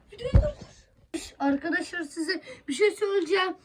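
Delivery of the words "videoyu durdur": whispered, no voice; whispering. Real, not acted. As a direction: breathe it without voicing. Repeated as whisper.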